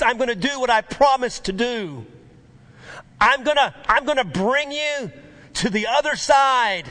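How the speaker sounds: loud, high, fervent preaching; preaching at full force. Real, not acted. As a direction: build the promise into a ringing shout. Preaching climax, shouting.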